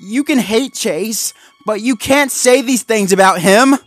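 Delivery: high, loud, distressed defence; upset protest. Acted. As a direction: stand up for a friend, upset. Marshall defends Chase, distressed.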